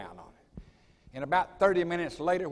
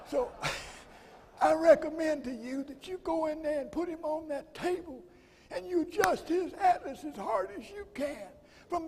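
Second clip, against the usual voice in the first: imitating Sterling; 12 semitones higher than usual. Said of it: "It's the way he talked".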